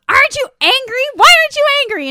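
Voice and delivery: high, loud demanding questions; retold exasperated shout; super angry. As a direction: demand it at the top of the voice. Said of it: "Grace is super angry".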